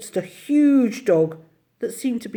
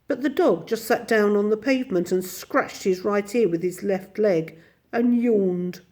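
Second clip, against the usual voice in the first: ordinary delivery, nothing marked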